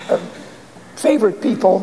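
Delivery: ordinary delivery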